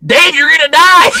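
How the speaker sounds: high, loud mock panic; frantic warning. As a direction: shout the warning while running past. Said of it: "He's like".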